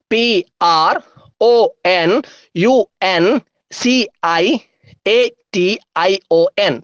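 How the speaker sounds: letters set apart with pauses; deliberate, letter-by-letter dictation; slow spelling. Shown as a demonstration. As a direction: spell it out slowly for writing down. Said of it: "സ്പീഡിൽ എങ്ങനെ പറയാം".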